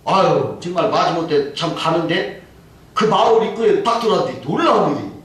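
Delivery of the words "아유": usual speaking voice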